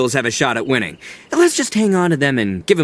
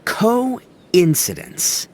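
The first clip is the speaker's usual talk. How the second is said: slow, weighty coincidence; drawn out; 4 semitones higher, much slower than usual. Acted. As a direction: savor the word coincidence slowly. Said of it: "this word that really sums it up nicely".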